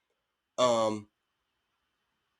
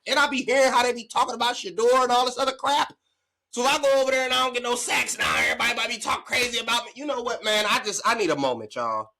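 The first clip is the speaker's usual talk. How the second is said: high pretend fretting, a bit fast; mock worried player; 8 semitones higher, 4 dB louder than usual. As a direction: play the player fearing the critics. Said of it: "That's how I would feel".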